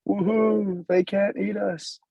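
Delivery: high, slow triumphant cheer. Acted. Women celebrate at the end.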